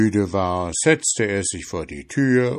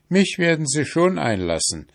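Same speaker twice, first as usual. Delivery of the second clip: higher, breezy confidence; bold prince; 8 semitones higher than usual. A narrator's reading. Prince shrugs off the wild beasts, high and breezy. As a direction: toss the answer back with a carefree lift.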